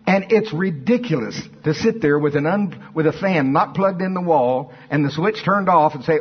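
usual speaking voice

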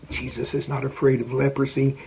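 ordinary talk

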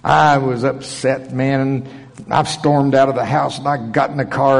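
ordinary talk, nothing marked

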